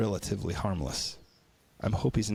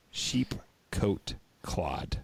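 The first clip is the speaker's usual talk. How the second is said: sheep coat clod, each word slow and separate; slow deliberate gloss; slow spelled-out translation; much slower than usual. Shown as a demonstration. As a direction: spell out the odd translation slowly. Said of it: "which means".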